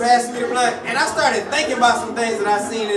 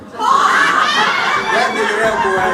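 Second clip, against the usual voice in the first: mock outrage; 5 dB louder, much slower than usual. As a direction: blow up slowly at the name.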